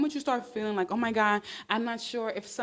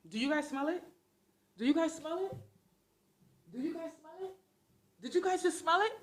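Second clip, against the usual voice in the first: hushed suspicion; 5 semitones higher, 6 dB quieter, faster than usual. Acted. Sniffing out a bad smell.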